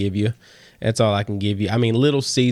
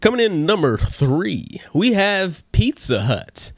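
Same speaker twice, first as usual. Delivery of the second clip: higher, slow radio host countdown reveal; 7 semitones higher than usual. Shown as a demonstration. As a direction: present it like a radio DJ. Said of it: "I like the D J voice".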